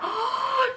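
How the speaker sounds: high breathy gasp of alarm; high startled gasp; shocked gasp. Retold or imitated. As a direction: suck in air, horrified. Seeing mirror, realizing wrong room.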